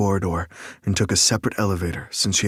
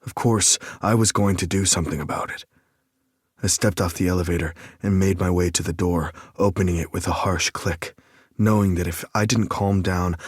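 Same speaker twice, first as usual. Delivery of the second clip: usual speaking voice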